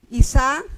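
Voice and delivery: "Isa" with the force on the final SA; stress on second syllable. Shown as a demonstration. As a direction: lean on the last syllable of "Isa". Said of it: "va a ir en la segunda sílaba".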